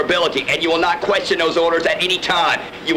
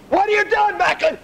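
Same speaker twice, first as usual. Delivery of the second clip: yelled repeat; 12 semitones higher than usual. Acted. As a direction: raise the voice on the repeat. Instructor yelling the question again.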